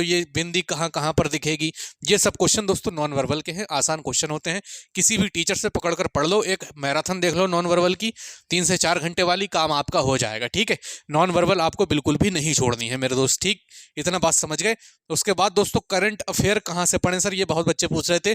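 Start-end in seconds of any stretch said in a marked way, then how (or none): none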